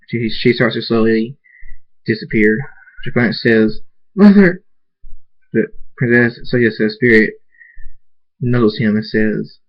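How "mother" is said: high, loud anguished call; crying out. Acted. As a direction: shout for her as she fades. Son calls vanishing mother.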